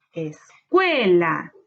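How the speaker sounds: load the voice onto the CUE of "Escuela"; low es, then long, punched CUE in "Escuela"; stressed syllable stretched. Shown as a demonstration. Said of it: "es la sílaba tónica".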